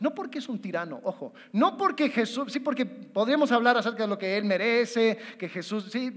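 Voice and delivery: ordinary talk, nothing marked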